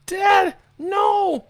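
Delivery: put-on very high, loud child's scream; a boy screaming as his dad dies; horrified scream. A narrator's reading. Boy watching his mother attack his dad, screaming. Father being killed.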